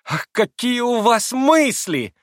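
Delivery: high, slow squeal of delight. A narrator's reading. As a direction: shriek with rapture. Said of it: "взвизгнула в восторге Лиза".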